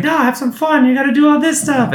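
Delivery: high goofy bear voice; squeaky, eager party host; Fozzie voice. Retold or imitated. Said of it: "Fozzie just like".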